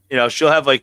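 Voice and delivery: normal talking voice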